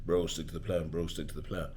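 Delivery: low, soft, repetitive coach voice; imitated coach. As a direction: drone it quietly like the coach's mantra. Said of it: "I just know exactly what he's gonna say".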